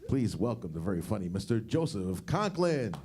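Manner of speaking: ordinary delivery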